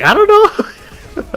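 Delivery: high, slow, laughing the words out; half lost in laughter; laughing shrug. Retold or imitated. Voicing friend's answer, slow laughing shrug.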